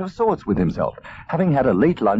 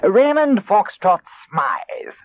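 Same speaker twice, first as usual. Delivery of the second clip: slow, higher grand fox voice; suave fox; 10 semitones higher, much slower than usual. Acted. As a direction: announce your own name grandly. Fox introduces himself.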